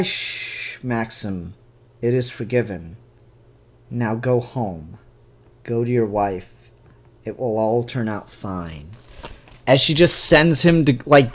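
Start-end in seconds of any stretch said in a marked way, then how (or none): 0.0-8.9 calm soothing hush. Retold or imitated. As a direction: soothe him softly while sealing his fate. Sophia calmly dismissing the man.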